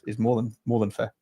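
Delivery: ordinary delivery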